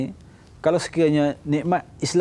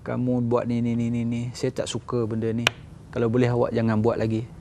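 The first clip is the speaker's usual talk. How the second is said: calm reprimand; 5 semitones lower, 6 dB quieter than usual. Retold or imitated. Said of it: "Dia kata".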